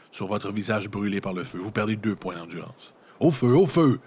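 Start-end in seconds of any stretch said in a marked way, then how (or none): 3.2-4.0 high, loud cry of alarm; shouting fire from the deck; panicked shout. A narrator's reading. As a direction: yell it like the ship is burning. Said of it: "crie alors une voix".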